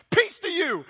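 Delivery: high, loud, exclamation-point greeting; exclaimed greeting. Acted. Jesus startling the frightened disciples with an exclaimed greeting. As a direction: burst out the greeting with an exclamation mark. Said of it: "I love it's an exclamation point".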